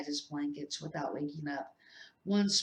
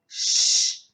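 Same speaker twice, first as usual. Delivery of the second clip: sharp hiss; 11 dB louder than usual. Acted. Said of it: "hissed Professor McGonagall".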